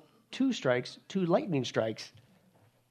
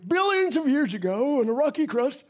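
high, louder sarcastic aside; mock evolutionist insert; 9 semitones higher, 4 dB louder than usual. Preacher mocks evolution in a high, louder voice while reading Genesis. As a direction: slip in the rival's version mockingly.

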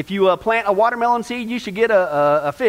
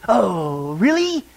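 sarcastic mutter; 2 semitones lower, much slower than usual. Acted. Hairdresser doubting the woman's Pope story, muttering sarcastically. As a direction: feign interest with heavy sarcasm. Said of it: "the hairdresser muttered sarcastically".

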